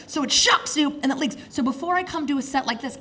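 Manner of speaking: normal talking voice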